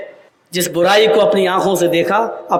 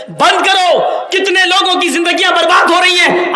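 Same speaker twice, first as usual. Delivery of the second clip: higher, louder protest outside the liquor shop; voiced cleric shouting; 9 semitones higher, 3 dB louder than usual. Cleric at the liquor shop shouting stop it. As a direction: cry out against the shop as the cleric. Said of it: "اگر یہ کہے کہ غلط ہے".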